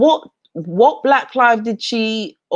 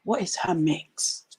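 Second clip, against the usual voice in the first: much softer, curious murmur of onlookers; hushed gossip; 10 dB quieter than usual. Retold or imitated. Strangers speculating about her background, in a hushed curious murmur. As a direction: mimic strangers wondering under their breath. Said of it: "I get treated more like".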